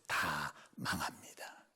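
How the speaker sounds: slow, low hushed warning; ominous slow whisper. Real, not acted. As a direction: whisper the doom slowly. Grave warning.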